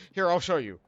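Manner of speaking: ordinary talk, nothing marked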